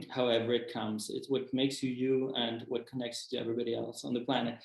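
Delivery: ordinary delivery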